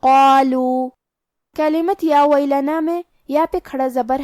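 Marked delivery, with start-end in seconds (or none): 0.0-0.9 "قالو" chanted with a heavy qaf and full, heavy long alif; heavy-voiced tafkheem. Shown as a demonstration. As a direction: recite "قالو" louder, heavy and drawn out. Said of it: "مد الف کو بھی بھاری آواز میں پڑھنا". ("قالو" بھاری آواز میں کھینچ کر)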